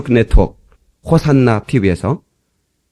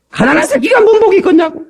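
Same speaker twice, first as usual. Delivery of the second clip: very high, scornful mock retort; 강의하는 양반 흉내. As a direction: mimic the lecturer's outraged challenge at a high pitch. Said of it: "아, 이래요". (한껏 높여 따지듯 흉내)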